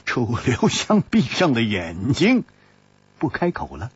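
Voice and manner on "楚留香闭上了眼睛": ordinary delivery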